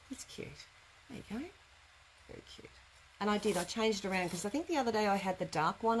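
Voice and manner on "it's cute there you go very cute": much softer murmured asides; quiet. Real, not acted. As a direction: murmur it to yourself. Murmured aside while handling basket.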